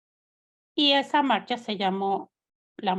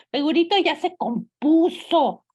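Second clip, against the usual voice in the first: higher, louder mocking voice of the children; mocking her kids; 8 semitones higher, 6 dB louder than usual. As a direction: toss it off teasing like a skeptical kid. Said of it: "mis hijos me decían". (voz burlona de hijo, más aguda y fuerte)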